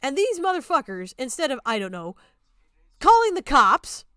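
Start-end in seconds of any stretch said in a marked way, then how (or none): 3.0-4.0 angry emphasis. Real, not acted. Angry rant.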